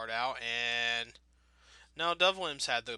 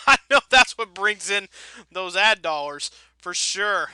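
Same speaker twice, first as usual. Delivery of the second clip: laughing; 3 semitones higher, 9 dB louder than usual. Real, not acted. Sarcastic aside about ad money.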